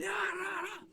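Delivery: high, wavering mock war cry; retold high strained yelling; imitated screaming. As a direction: holler like a raider, held back in volume. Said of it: "he's screaming around".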